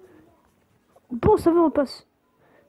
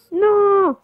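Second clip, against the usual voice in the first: loud high cry of no; dismayed shout; 7 semitones higher, 7 dB louder than usual. Real, not acted. Missed the pickup.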